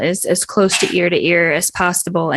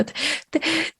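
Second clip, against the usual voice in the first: higher, louder, crying thanks with stuttered start; choked out through tears; 11 semitones higher, 3 dB louder than usual. Acted. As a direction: choke the words out through sobs. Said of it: "she sort of chokes out".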